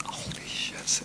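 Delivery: stunned whisper; whispered shock. Retold or imitated. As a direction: breathe it out in disbelief. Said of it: "And I thought".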